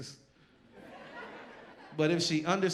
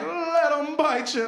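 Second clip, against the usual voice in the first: much higher, slow, louder; serene preacher; 8 dB louder, slower than usual. Retold or imitated. Imitating King calmly telling marchers to accept bites, high and slow. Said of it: "He's like".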